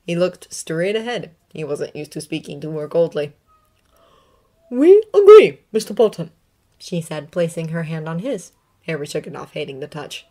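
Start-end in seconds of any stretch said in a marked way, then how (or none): 4.7-6.3 put-on high, stern older woman; clipped Scottish teacher voice; McGonagall voice. A narrator's reading.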